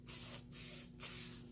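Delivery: three short soft "tss" sounds; mocking monkey hiss. Monkeys mimicking the peddler from the tree, hissing. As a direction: tease with short voiceless hisses.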